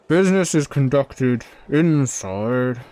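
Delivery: booming, drawn-out character voice; gruff forge dweller. Acted. Forge dweller summoning a visitor inside, slow and loud.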